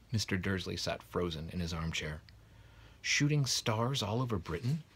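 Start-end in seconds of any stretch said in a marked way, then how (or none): none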